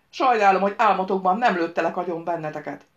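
loud, furious regret; angry outburst. A narrator's reading. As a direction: burst out loudly at your captors. Hanging captive rages at captors. (dühös, hangos kifakadás)

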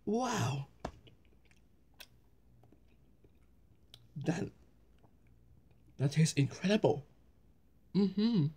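speaking between bites of food; talking while chewing. Real, not acted. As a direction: mouth partly full. Seen 0: bites slice at -4s; cheeks bulge, chewing while talking, slice in hand.

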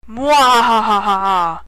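slow, drawn-out villain cackle; evil laugh. Acted. Gloating after the villain line.

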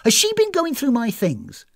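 high, indignant accusation; snappy, suspicious guest. Acted. Guest accusing hostess of prying, indignant. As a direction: demand an answer, defensive and rising.

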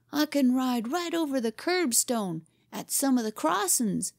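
put-on high, bright boyish boast; bright announcement. A narrator's reading. Boy tries to rebuild his battered glories, bright. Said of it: "he announced brightly".

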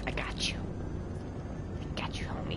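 whispered reassurance. Real, not acted. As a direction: whisper it to your teammate. Reassuring teammate mid-fight.

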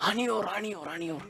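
soft parrot murmur. Retold or imitated. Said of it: "He said".

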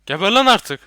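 loud, high "Geber lan artık"; angry shout. Real, not acted. Gamer shooting a monster, angry shout. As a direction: yell it at the monster.

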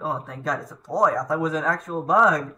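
ordinary talk, nothing marked

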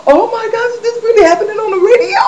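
very high, squealed mock astonishment; shocked disbelief. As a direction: squeal it fast, acting out shock at what you heard. Her shocked reaction, re-enacted.

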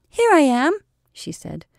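put-on high, bright child asking for a hug; little girl voice. A narrator's reading. Little girl stepping out to the captains, high and childlike. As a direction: voice her like a small girl.

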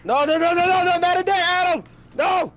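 high panicked yelling; frantic shouts while fleeing; being chased. Real, not acted. Fleeing enemies into a building.

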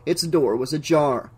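plain reading voice

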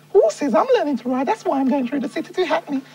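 very high put-on woman's voice; lady from Hawaii. Lady tourist asking a local man for directions, high woman's voice. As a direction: play a female tourist in high falsetto. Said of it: "sexy lady. She said to me".

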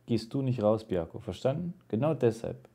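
very soft aside to a pet; quiet admonishing. Real, not acted. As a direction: drop the volume for a private little lecture. Quietly lectures own pet mid-story. (leise Ermahnung ans Haustier)

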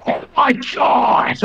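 high, stretched-out exclamation; mock commentator shock. Shown as a demonstration. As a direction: draw it out in fake astonishment. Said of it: "we both just go".

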